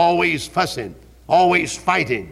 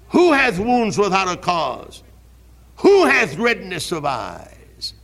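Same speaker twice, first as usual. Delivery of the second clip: raised, ringing scripture reading, each Who hit hard; preaching at full force; 5 semitones higher, slower than usual. Acted. Preacher reading Proverbs to his church, full preaching voice. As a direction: proclaim each question to the congregation.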